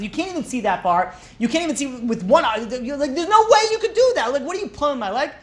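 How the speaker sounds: high-pitched, mounting mock disbelief; incredulous protest. Slave owner challenges his slave's far-fetched claim, incredulous.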